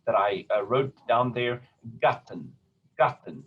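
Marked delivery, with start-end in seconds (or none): 2.0-3.4 GATTEN with the first syllable stressed, second dropped. Shown as a demonstration. Teacher shows German word stress to singers, Gatten stressed on first syllable. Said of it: "it's clearly on the first syllable".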